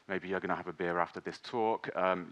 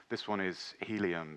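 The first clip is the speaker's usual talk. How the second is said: normal talking voice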